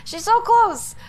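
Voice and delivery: high excited exclamation; thrilled to hear her nearby. Real, not acted. Excited discovery.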